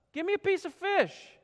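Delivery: a hint of Jesus joking with his friends; playful Jesus. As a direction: ask for the fish with a grin, teasing the disciples. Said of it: "And he says".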